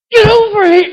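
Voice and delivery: high, loud, exasperated call; a dad at the end of his rope; exasperated yell. Retold or imitated. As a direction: yell it across the parking lot at your wife. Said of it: "I'm like".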